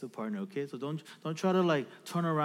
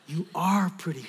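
usual-pitched, emphatic reassurance; father's affirmation. Retold or imitated. Dad answers his little daughter, steady affirmation. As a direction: affirm her, leaning on "are". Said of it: "And then I go".

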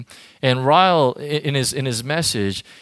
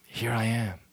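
soft, humble prayer; quiet prayer; 4 semitones lower, 7 dB quieter than usual. Acted. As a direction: hand yourself over to God, softly. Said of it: "and just say".

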